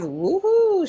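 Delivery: high-pitched, drawn-out whoop; celebratory whoop. Retold or imitated. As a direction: read it as a happy whoop. Reads out a celebrating comment.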